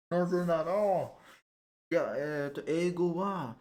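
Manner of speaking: playing up a put-on Japanese accent; fake Japanese. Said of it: "Fake Japanese".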